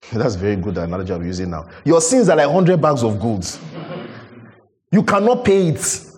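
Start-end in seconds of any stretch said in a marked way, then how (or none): 1.8-6.0 impassioned preaching. Acted. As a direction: drive the debt image home with fire. Preaching at full force.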